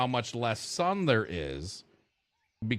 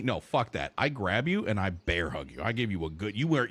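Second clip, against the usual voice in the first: usual speaking voice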